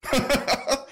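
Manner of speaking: bright, high laugh of amusement. Real, not acted. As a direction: crack up at it. Laughing at the quote.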